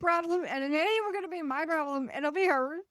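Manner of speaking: high, fast mocking voice of the teacher; high mock of the outraged teacher. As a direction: squeak it out like the indignant teacher. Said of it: "she would just be like".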